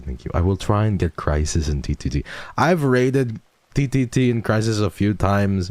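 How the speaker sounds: ordinary delivery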